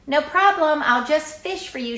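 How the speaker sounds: lightly voiced cheerful mom; breezy mom. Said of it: "mother's breezy reply".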